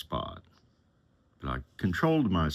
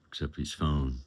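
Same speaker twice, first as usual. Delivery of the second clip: usual speaking voice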